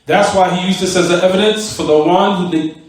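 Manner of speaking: ordinary delivery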